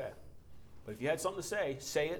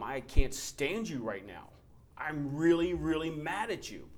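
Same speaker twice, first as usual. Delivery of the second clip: slow, louder, half-mock angry words to God; retold angry prayer; 3 semitones higher, 6 dB louder, slower than usual. Pastor retelling his prayer in the woods, mock angry. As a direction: let the frustration show, not a full scream. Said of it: "I'm screaming at God".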